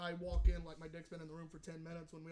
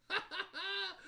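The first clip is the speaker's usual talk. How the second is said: high mock laugh; imitated laughing; 11 semitones higher, slower than usual. Brother laughs at his insulted sibling, imitated high laughing. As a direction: imitate a brother cackling, pitched well up. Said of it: "He just started laughing".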